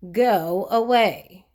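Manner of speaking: slow, curt brush-off; a rich grump waving off a pest; rude. Acted. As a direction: dismiss him rudely, slowly. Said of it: "said Mister Uppity rudely".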